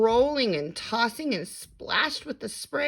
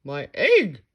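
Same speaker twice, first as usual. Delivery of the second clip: louder joyful cry; shouted; 3 semitones lower, 7 dB louder than usual. A narrator's reading. Horton seeing his egg hatch, loud cry. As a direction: call it out in delight. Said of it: "shouted Horton".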